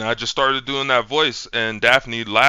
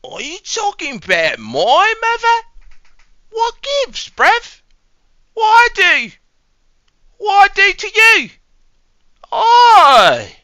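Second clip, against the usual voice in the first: very high, loud indignant squeal; offended cartoon Brit; 8 dB louder, slower than usual. Lad offended about his mother, piercing cartoon British voice. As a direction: squawk it in a squeaky mock British accent.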